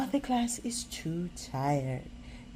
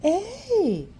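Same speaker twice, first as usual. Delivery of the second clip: laughing; 10 semitones higher, 7 dB louder than usual. A narrator's reading. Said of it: "laughs Arnold".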